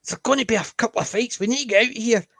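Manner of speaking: nervous Englishman. Retold or imitated. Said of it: "he's going".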